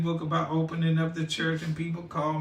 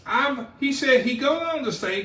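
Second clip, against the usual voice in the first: normal talking voice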